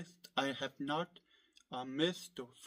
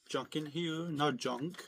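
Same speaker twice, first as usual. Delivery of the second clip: ordinary talk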